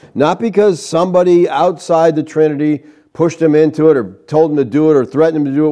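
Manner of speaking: ordinary delivery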